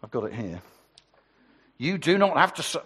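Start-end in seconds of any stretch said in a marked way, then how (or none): 1.8-2.8 a hint of a formal police officer; lightly voiced officer reading out the caution. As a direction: read the caution like a copper. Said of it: "I've got my best police voice".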